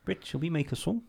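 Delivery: quiet Kiwi impression from a comedy show. Band manager from Flight of the Conchords, soft Kiwi impression. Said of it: "I used to do quite a good [name]".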